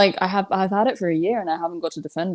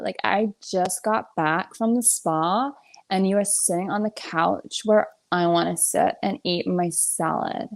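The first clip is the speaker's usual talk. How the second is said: slow, drawn-out American accent impression; Kim Kardashian; much slower than usual. Putting on an American accent to make the host laugh, hammed-up complaint. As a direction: drag out the vowels in a put-on American accent. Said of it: "my Kim Kardashian one".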